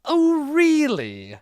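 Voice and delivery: high, loud, slow sneer. Acted. De Aragon mocking the old master, high sneer. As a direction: drag it out with contempt. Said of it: "De Aragon sneered".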